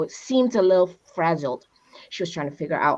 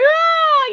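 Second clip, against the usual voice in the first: high, tearful "Ah"; moved cry; 6 dB louder than usual. Pretend. Stranger reacting when someone offers a hug, tearful. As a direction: let out an overwhelmed little cry.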